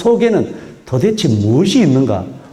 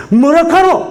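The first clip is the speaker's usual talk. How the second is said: 뭐라카노 cried out much higher and louder in shock; stunned disbelief; 13 semitones higher, 4 dB louder than usual. Retold or imitated. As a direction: gasp out 뭐라카노 as if hearing a son has died. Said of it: "자기 아들이 갑자기 죽었거나 도대체 믿기 어려운".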